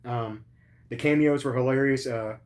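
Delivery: ordinary delivery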